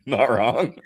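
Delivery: laughing. Real, not acted. Repeated agreement.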